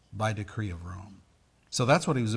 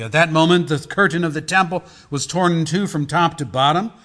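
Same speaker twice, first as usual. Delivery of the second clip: raised, forceful Gospel reading; preaching scripture; 5 semitones higher, 13 dB louder than usual. Acted. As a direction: read it out firmly to the congregation. Reads Gospel aloud.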